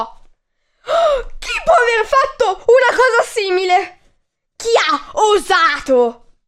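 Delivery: high, loud wailing cry; giraffe crying out. Acted. Giraffe wakes up painted yellow and cries out in dismay. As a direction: wail in shock at the painted coat. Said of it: "Gridò e urlò".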